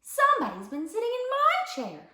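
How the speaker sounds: high mama bear voice; middle-sized mama bear. A narrator's reading. Mama bear finds her chair used, middle-sized voice. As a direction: lift it into a mama bear's register. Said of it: "said the mama bear in her middle-sized voice".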